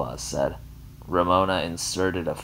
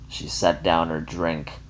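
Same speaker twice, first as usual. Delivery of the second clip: usual speaking voice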